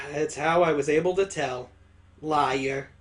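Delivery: ordinary delivery, nothing marked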